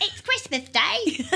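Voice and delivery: high, slow street-urchin voice; hammed-up boy proudly announcing the news; turkey boy. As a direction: draw it out like a comic child character.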